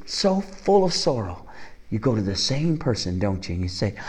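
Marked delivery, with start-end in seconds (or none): none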